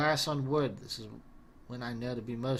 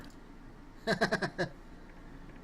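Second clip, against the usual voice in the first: breathy laugh; 5 semitones higher, 5 dB quieter than usual. Acted. Laughing at the character.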